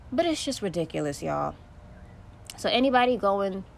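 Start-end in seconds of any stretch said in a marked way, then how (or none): none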